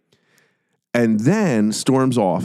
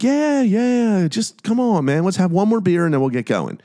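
higher, sing-song placating voice; mock Dahmer coaxing; 10 semitones higher than usual. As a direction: coax him to stay in a high put-on voice. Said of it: "And Dahmer's like".

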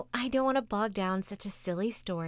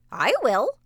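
loud, higher quack of a duck; noisy duck quacking; 6 semitones higher, 10 dB louder than usual. Acted. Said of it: "quacked the noisy yellow duck".

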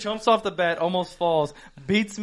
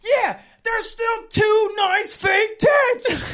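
high, slow, drawn-out shout; hammed-up shouting match; mock arguing; 4 dB louder, much slower than usual. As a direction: yell it back, stretching every word. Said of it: "We sound like we're in an argument".

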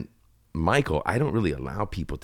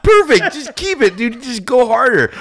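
excited urging; 14 dB louder than usual. Retold or imitated. Director urging him on.

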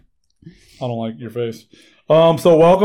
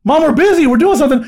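exasperated son; 11 semitones higher, 4 dB louder than usual. Retold or imitated. Son answering mom in story.

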